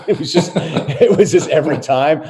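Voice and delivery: laughing. Real, not acted. Laughing at old Phoenix trade talk.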